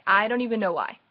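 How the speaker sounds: ordinary talk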